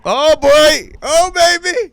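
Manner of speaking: high, loud whoop of anticipation; mock-thrilled, way up in pitch; hammed-up excitement. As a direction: crow it like a hyped-up sidekick. Hams up excitement at coming story.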